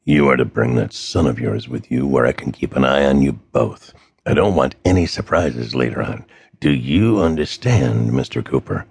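low, gravelly threatening voice; a cold kidnapper laying down terms; gravelly phone villain, low and menacing. A narrator's reading.

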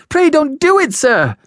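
put-on high, frightened child begging; loud high-pitched begging; pleading in terror. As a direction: plead in a squeaky, scared boy's voice. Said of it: "I pleaded in terror".

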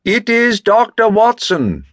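calling out. Acted. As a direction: announce yourself to someone behind a door. Calls through a closed door.

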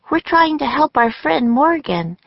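put-on high-pitched girl character voice; Annie. A narrator's reading. Annie to the silent ninjas about Morgan, high earnest voice.

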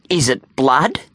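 understated mysterious question. A narrator's reading. Boy posing as vampire questions bully, mildly mysterious. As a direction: ask with a touch of vampire mystery. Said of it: "still in his deep, mysterious voice".

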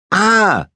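high, sudden squeal; girl's squeal. A narrator's reading. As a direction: squeal as a berry hits you. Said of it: "squealed Leonie".

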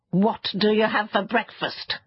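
mildly impatient creature, pressing the question; a touch of exasperation. Acted. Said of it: "the fairy said impatiently".

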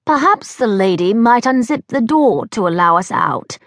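a light touch of a gracious grandmother; gentle British gran. Acted. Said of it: "came a gentle voice".

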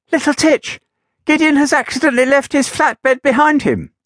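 high, urgent tiny woman's voice; breathless tiny woman. Acted. Cleaner telling Little Titch about the flatbed, high and urgent. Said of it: "Almost out of breath".

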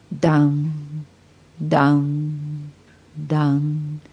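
slow tolling clock, held on one level note; rintocchi d'orologio. A narrator's reading. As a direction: imitate distant chimes, letting each fade. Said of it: "sentii i rintocchi dell'orologio". (rintocchi imitati, tenuti e smorzati)

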